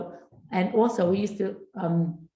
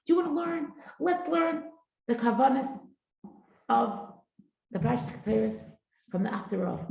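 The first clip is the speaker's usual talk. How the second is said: eager invitation; 6 semitones higher than usual. Retold or imitated. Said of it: "she would say".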